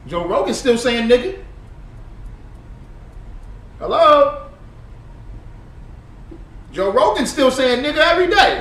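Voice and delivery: high, loud, incredulous ranting. Real, not acted. Ranting loudly to viewers about a clip. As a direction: shout it out in disbelief.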